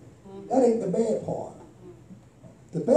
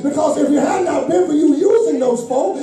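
high, loud shouted preaching climax; loud and higher, pushing every line to the congregation; preaching at full force; 5 semitones higher, 6 dB louder than usual. Acted. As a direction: lift the prayer into a shouting crescendo. Preaching climax, prayer quote.

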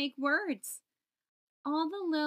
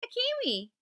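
high, pleased little voice; knowing answer; 11 semitones higher than usual. Acted. Little K proudly suggesting a kiwi, high. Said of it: "Little K knew the answer".

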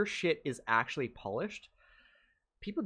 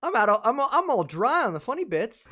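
high, louder squeaky complaint; mock whiny editor; 11 semitones higher, 9 dB louder than usual. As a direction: whine like a tired little employee. Editor answering boss in mock voice.

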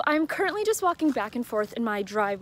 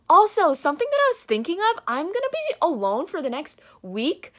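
much higher and louder, bursting with glee; excited; 9 semitones higher, 9 dB louder than usual. Real, not acted. Vlogger telling viewers her secret accent plan, giddy and loud. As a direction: gush it out like you can't wait.

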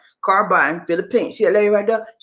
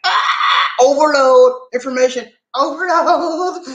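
hammed-up wail of overload, louder and higher; mock overwhelmed cry; 8 semitones higher, 3 dB louder, slower than usual. Viewer overwhelmed by car info, mock wailing. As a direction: throw up your hands at too much info. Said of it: "And you'll be like".

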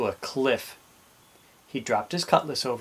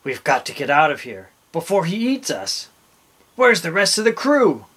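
louder, rising to a high question; urgent captain; 4 semitones higher, 6 dB louder than usual. A narrator's reading. Captain to fleeing crew, urgent and commanding. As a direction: press them urgently, building in volume. Captain urging escape from danger.

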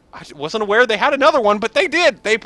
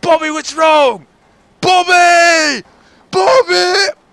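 hammed-up yelling for Bobby; 5 semitones higher, slower than usual. Begging Bobby for a push, hammed-up desperate yelling. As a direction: bellow for Bobby like he's drifting away. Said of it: "Not gonna do that voice again".

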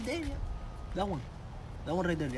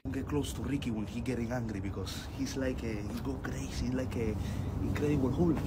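quoted warning; 5 semitones lower, 10 dB quieter than usual. Retold or imitated. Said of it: "He said".

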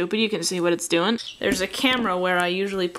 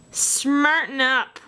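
mock self-scolding; 5 semitones higher, much slower than usual. Real, not acted. Artist telling herself off for a mistake, mock-stern scolding. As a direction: scold yourself slowly and emphatically.

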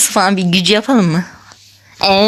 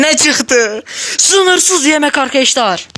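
higher, slowed, half-crying delight; play-cry with happiness; mock tearful joy; 6 semitones higher, slower than usual. Overjoyed at the game result.

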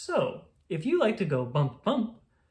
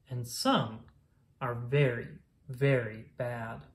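slow, drawn-out and ominous; mock-grave very bad; 4 semitones lower than usual. A narrator's reading. Reading to a child, slowly stretching out very bad. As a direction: drag out very very bad for effect. Reading a picture book aloud.

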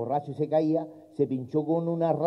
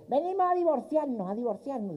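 put-on old woman voice; 10 semitones higher, 4 dB quieter than usual. Old couple at the lawyer.

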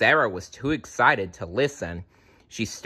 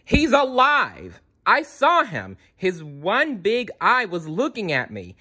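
high-pitched indignant insistence; insistent outburst; 10 semitones higher than usual. A narrator's reading. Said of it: "Sarah stamped her feet".

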